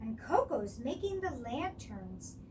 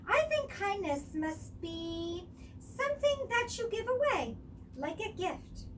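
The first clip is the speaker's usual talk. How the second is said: higher, musing friend voice; Coco thinking; 8 semitones higher than usual. A narrator's reading. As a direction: wonder it through gently.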